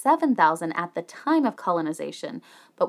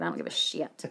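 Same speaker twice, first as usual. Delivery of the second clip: whispered, much lower and softer throwaway; muttered aside; 14 semitones lower, 10 dB quieter than usual. Real, not acted. Host shrugs off metric units in a whispered aside. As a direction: toss it under your breath.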